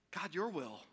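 low, very soft prayer; hushed submission. Acted. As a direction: let it fall to a reverent hush. Voicing Jesus' final submission.

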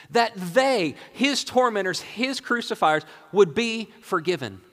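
fervent sermon crescendo; impassioned preaching. Acted. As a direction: preach it in rising wonder, stressing each phrase. Preacher marvels at forgiveness.

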